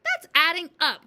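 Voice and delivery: loud, high outcry; crying out. A narrator's reading. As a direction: blurt it out loudly in protest. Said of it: "Wilford cried".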